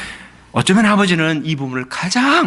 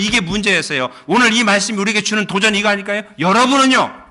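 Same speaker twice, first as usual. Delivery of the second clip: raised, higher, louder pulpit voice; impassioned preaching; 8 semitones higher, 5 dB louder than usual. Acted. Pastor pressing the congregation, fervent preaching. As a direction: drive the point home to the church.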